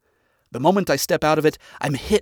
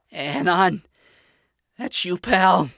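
higher, pained croaking voice; 6 semitones higher, 4 dB louder, slower than usual. Acted. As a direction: croak it out, hurting. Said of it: "the pained voice croaked".

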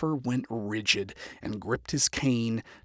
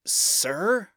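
higher, tentative query; concerned; 8 semitones higher than usual. A narrator's reading. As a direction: address a superior with concern. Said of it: "Loot addressed in concern".

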